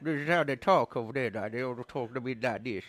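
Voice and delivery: put-on mumbled Texas accent, teeth clenched. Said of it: "my Texas accent".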